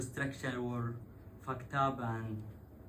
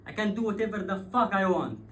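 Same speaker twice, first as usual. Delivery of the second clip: louder, higher defiant snap; defiant retort; 8 semitones higher, 10 dB louder than usual. Retold or imitated. Blocking driver defying the queue, defiant loud retort. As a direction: throw it back as a challenge.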